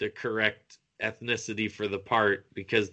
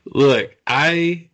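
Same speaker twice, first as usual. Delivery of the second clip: emphatic opener; 5 semitones higher, 10 dB louder than usual. Real, not acted. Loud start to his story.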